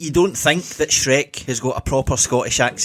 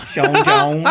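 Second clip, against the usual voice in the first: laughing; 3 dB louder than usual. Acted.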